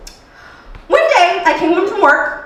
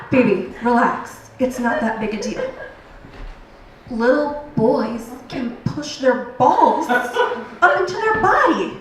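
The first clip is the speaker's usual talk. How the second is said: lower, softer, laid-back man's voice; calm husband; 5 semitones lower, 6 dB quieter than usual. Retold or imitated. Husband on the phone soothing his wife, lower and softer. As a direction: drop low and easy, no worry. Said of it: "He's like".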